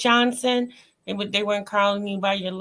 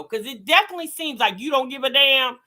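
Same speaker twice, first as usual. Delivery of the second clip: ordinary talk, nothing marked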